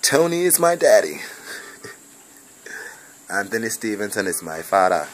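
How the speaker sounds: a hint of Jamaican accent, teasing the family; mock Jamaican accent. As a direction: try on a Jamaican accent for fun, half laughing. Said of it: "I don't sound like no real Jamaican".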